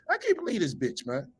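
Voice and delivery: words dissolving into laughter; helpless, disbelieving laugh; incredulous laughing. Real, not acted. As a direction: crack up at the absurdity. Cannot believe it, laughs.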